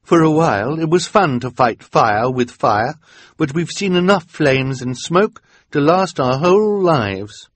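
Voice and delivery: impassioned refusal; weary woman. A narrator's reading. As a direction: done with the fire. Woman explains.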